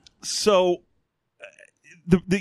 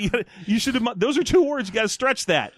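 mock scolding correction; 7 semitones higher than usual. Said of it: "And you're like".

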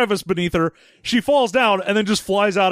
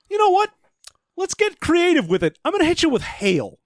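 higher, eager voice building to a fast finish; excited idea; 10 semitones higher than usual. Retold or imitated. Storm deciding to hit him with hail, gleeful excitement. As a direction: get carried away with a fun idea. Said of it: "I was like".

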